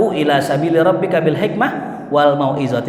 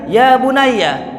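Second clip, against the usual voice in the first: calling out; 11 semitones higher, 5 dB louder, much slower than usual. Acted. Sayyidina Ali calls the shaykh.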